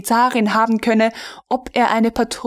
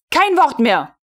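cutting off; 6 semitones higher, 3 dB louder than usual. A narrator's reading. Melin cuts him off.

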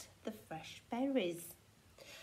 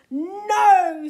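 very loud, high, long no; explosive shout of refusal; screamed refusal; 7 semitones higher, 17 dB louder than usual. A narrator's reading. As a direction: scream it in a tantrum. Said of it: "screamed Tiny".

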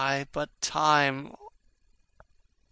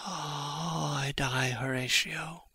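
fading dying words; 10 dB quieter than usual. Acted.

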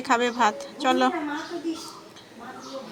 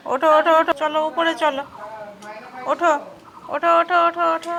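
coaxing call; 2 semitones higher, faster than usual. Real, not acted. Mother calling her son to get up, urging.

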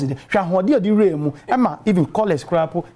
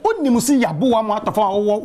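ordinary talk

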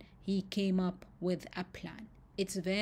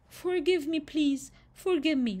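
high, pleading repeated plea; begging; 7 semitones higher than usual. Acted. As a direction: beg over and over, higher each time. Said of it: "that was the way he talked".

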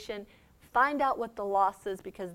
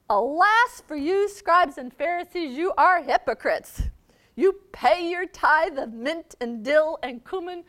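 angry Jesus; 7 semitones higher, 11 dB louder than usual. Acted. Jesus denouncing the scribes and Pharisees, loud and high. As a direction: hammer each charge at the hypocrites. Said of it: "the seriousness of his anger".

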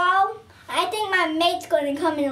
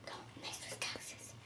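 whisper; 24 dB quieter than usual. Pretend. Quoting road signs.

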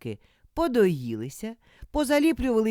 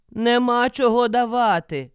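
higher, loud protest; loud refusal; 4 semitones higher, 7 dB louder than usual. A narrator's reading. Old man turns the wolf away, loudly refusing. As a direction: call back a firm refusal.

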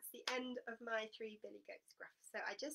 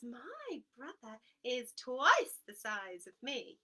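slow goat boast; 4 semitones higher, 10 dB louder, slower than usual. Acted.

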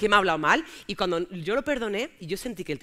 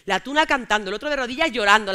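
normal talking voice

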